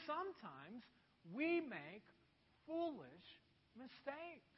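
soft, fading, slow sermon point; quiet preaching. Acted.

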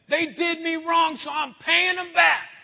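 mock the grudge-holder shouting payback; preacher voicing the revenge attitude as a shout; vengeful shout. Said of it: "an attitude that".

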